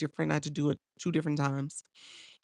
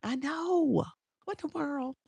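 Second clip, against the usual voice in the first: amused disbelief; 10 semitones higher, slower than usual. Real, not acted. Reacting to a funny video.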